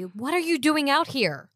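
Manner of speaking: loud, high, urgent demand; alarmed scolding. Acted. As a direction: confront them in exasperated alarm. Acted quarrel, confronting companion outside walls.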